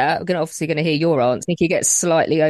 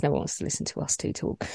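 whispered aside; 9 dB quieter, faster than usual. Real, not acted. Self-deprecating aside, unvoiced.

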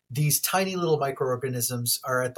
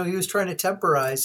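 ordinary delivery

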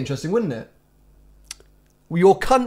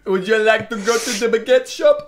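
high-pitched put-on French English; English with a hammed-up French accent, pitched up; mock French accent; 7 semitones higher, 3 dB louder than usual. Tourist inviting someone to the baguette shop, mock French accent. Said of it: "speak English in a French accent".

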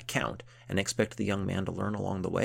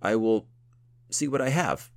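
stammering; 6 semitones higher, 8 dB louder than usual. Acted. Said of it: "stammered Thibault".

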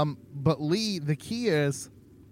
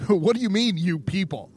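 laughing; 4 semitones higher than usual. Real, not acted. Laughing while challenging partner.

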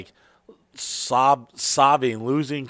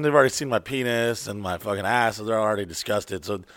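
usual speaking voice

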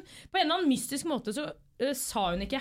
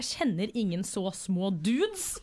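slowly drawn out denial; slow deadpan quote; 4 semitones lower, much slower than usual. Retold or imitated. Host quoting Runar's dry denial, slow deadpan. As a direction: take it slow, mock-serious. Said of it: "Runar bare".

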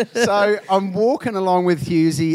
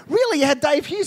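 much higher, teasing fake surprise; mock disbelief; 9 semitones higher than usual. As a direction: play dumb to bait him. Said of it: "And I go".

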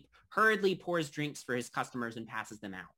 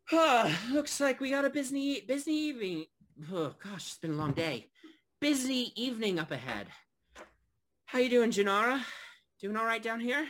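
higher-pitched barkeep greeting a regular; tired friendly barkeep; 10 semitones higher, 5 dB louder than usual. Acted. Barkeep checks on his regular at the bar, higher put-on voice. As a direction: sigh into a higher, put-on innkeeper voice. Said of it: "he comes over to you and says".

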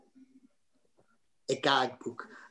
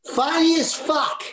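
higher, louder shouted call; 14 semitones higher, 5 dB louder, slower than usual. Retold or imitated. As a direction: shout it from across the street. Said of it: "one of them went".

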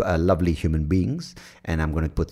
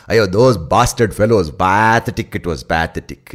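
put-on scorn them in a broad Indian accent; thick Indian accent; 7 dB louder than usual. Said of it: "adds this flavor of curry".

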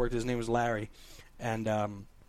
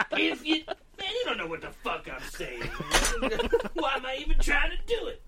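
Larry; 9 semitones higher than usual. Retold or imitated. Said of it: "that's exactly how he talked".

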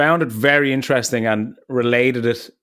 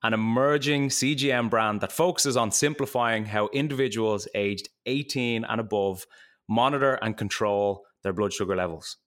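ad read; 7 dB quieter than usual. Acted. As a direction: read it like a podcast ad. Sponsor ad read before show.

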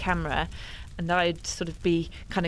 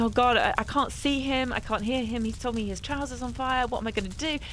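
higher, anxious inner voice; worried thoughts; 6 semitones higher than usual. Retold or imitated. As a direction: retell the fear of the moment. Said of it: "I was thinking".

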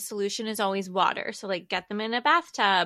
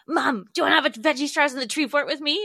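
put-on high, louder, quick little kid voice; child voice; 6 semitones higher, 5 dB louder, faster than usual. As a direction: imitate an eager kid inviting mom. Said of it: "he calls me".

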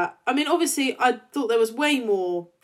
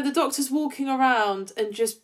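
usual speaking voice

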